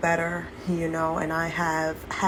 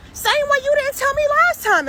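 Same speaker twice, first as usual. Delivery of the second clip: much higher and louder, near yelling; indignant shout; 6 dB louder, faster than usual. Real, not acted. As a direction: yells the accusation back. Ranting at the relative.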